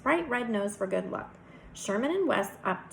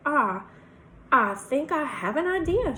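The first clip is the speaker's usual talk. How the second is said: higher Southern lady's voice; sweet Southern drawl; 5 semitones higher, 5 dB louder than usual. A narrator's reading. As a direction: float the idea in a Southern drawl. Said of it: "speaks in a sweet southern drawl".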